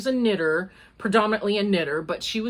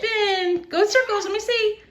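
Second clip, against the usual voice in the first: high, coaxing grandma voice to a little one; sing-song encouragement, pitched well up; 11 semitones higher than usual. Real, not acted. Grandma coaxing her granddaughter to spin, high baby talk.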